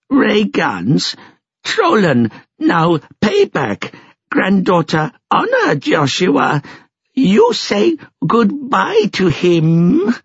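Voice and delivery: high, slow broken-English creature; stately high creature speech; high-pitched alien creature, solemn. A narrator's reading. Finn McCool explains the deal.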